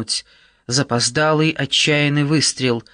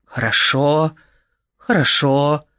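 drawn-out repeated word, like a charmer; incantatory mumbling. A narrator's reading. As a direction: intone it like a soothing spell. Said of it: "бубнил Нат на манер заклинателя".

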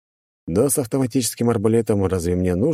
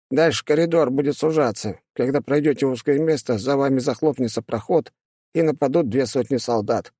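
high demon voice; 7 semitones higher than usual. Acted. Demon explains trap.

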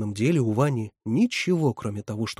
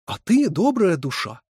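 slow, higher, louder mock-pitying sigh; 7 semitones higher, 5 dB louder than usual. A narrator's reading. Резина chides Катерина for kindness, sighing. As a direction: draw it out with an exasperated sigh. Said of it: "вздохнула Резина".

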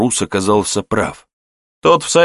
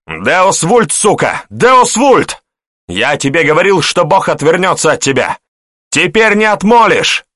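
full-force roar; 10 semitones higher, 5 dB louder than usual. A narrator's reading. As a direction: bellow it high and loud across a field. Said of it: "рявкнул, что было сил". (рявкать что было сил)

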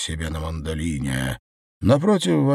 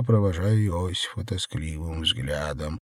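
ordinary delivery

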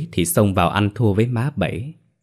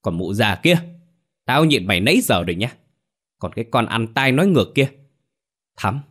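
higher, quick angry tirade, narrated; furious yelling; 6 semitones higher, faster than usual. A narrator's reading. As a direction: scold hotly, pitch climbing as you go. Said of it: "nổi điên, hét lớn".

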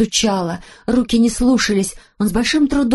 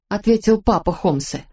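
plain reading voice

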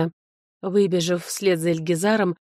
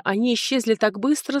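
ordinary delivery

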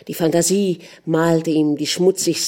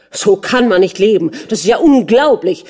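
loud, indignant outburst; shouting; 4 semitones higher, 7 dB louder than usual. A narrator's reading. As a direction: raise the voice in outrage. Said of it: "schrie der Fürst weiter".

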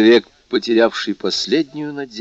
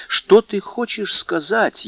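slow and much higher, challenging; proud challenge; 10 semitones higher, slower than usual. A narrator's reading. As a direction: throw the question back defiantly. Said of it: "спросил Мерседес с гордым вызовом". (гордый вызов, медленно и высоко)

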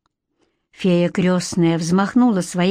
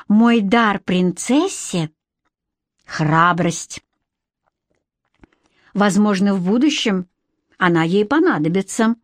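solemn fairy godmother. A narrator's reading. Fairy godmother gives her gift.

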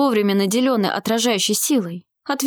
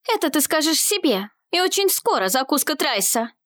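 higher-pitched defiant comeback; tossed-back retort; 7 semitones higher than usual. A narrator's reading. Girl answering the dark mage's threat, defiant retort. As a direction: throw the taunt straight back, pitched up. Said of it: "бросила в ответ Елеоль".

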